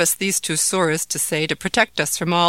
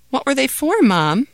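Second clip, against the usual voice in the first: put-on curious child; 8 semitones higher, 6 dB louder than usual. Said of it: "wondered Ahmed".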